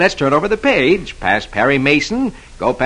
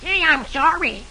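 put-on small boy voice; 13 semitones higher, 5 dB quieter than usual. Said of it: "Dewey replies".

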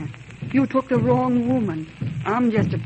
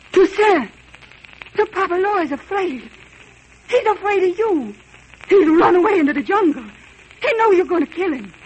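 louder, higher, fast joyful rush; excited relief; 5 semitones higher, 4 dB louder than usual. Acted. Wife excitedly telling Toussaint the priest fled. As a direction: tell him breathlessly he won.

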